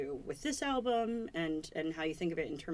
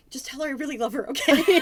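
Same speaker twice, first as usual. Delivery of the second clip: gushing; 7 semitones higher, 12 dB louder than usual. Retold or imitated. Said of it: "and she's like".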